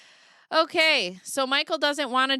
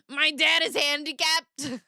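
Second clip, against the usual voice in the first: high, drawn-out catchphrase; mock announcement; 6 semitones higher, 4 dB louder, slower than usual. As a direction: belt the catchphrase out slowly and high. Catchphrase, then corrects her own quote.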